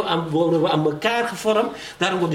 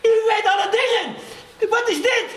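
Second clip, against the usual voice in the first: crying out to God. Retold or imitated. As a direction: cry out to God in pain, with a broken laugh. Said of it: "schreeuwde ik tot God".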